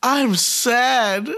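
put-on high kid voice, drawn out; son. Kid walks in to tell dad bad news, mock-sad high voice. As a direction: imitate a sad little kid, pitched high. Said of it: "my kid that walked in the other day and goes".

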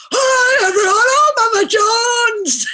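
high, loud, hammed-up shout; Chris Moyles mimicking her accent. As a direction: belt out an exaggerated, screechy mock of a co-host's accent. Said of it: "He puts on my accent and goes".

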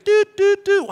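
high, steady beeping doots; dial tone imitation. Imitating the dial tone heard on the payphone. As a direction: hum it like a phone tone.

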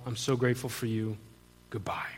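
hushed, retold goodbye dropping almost to nothing; subdued farewell. Brother saying goodbye to his sister, subdued farewell. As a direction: trail down softly into a whisper.

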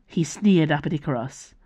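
plain reading voice

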